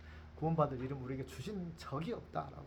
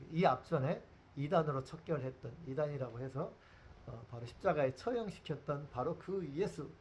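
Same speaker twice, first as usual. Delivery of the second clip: ordinary talk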